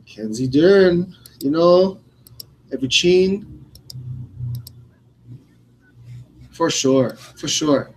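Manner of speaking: put-on accent, high and loud, mocking her catchphrases; Mackenzie Dern. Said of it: "the attention she gets for the accent thing".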